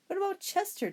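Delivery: very high, bright question; a kid suddenly remembering the cat; Toby asks his mom about the cat; eager boy. A narrator's reading.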